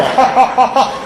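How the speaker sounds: high, punched-out mock laugh; string of separate put-on ha's; imitated laugh. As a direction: act out two buddies cackling on cue. Buddies' laugh repeated in story.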